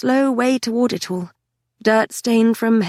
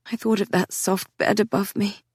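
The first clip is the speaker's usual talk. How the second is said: ordinary delivery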